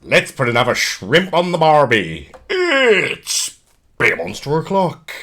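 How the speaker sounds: loud, hammed-up Australian accent; put-on Australian accent, loud and cheeky; dodgy Australian accent. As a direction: boom out a mock Aussie greeting. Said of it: "why the dodgy Australian accent".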